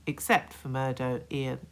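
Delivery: plain reading voice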